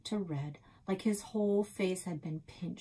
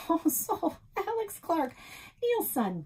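stammering; 10 semitones higher, 3 dB louder than usual. A narrator's reading. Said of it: "Nan stammered".

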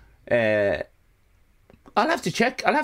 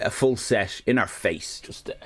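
usual speaking voice

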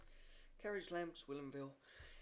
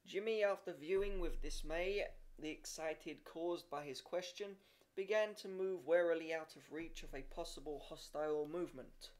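ordinary delivery, nothing marked

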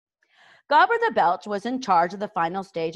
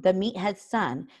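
ordinary delivery

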